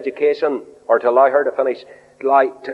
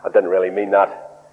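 ordinary talk

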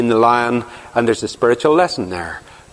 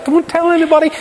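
high, whiny pleading to Samson; nagging wife; faster than usual. Retold or imitated. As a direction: beg and nag, much higher and wheedling. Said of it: "she cries all day".

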